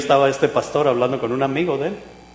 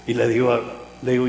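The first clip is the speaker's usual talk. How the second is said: ordinary delivery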